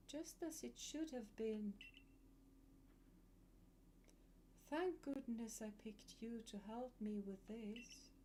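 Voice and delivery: hushed and haunting, like a ghost; eerie voice. Acted. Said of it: "I try to do spooky voice".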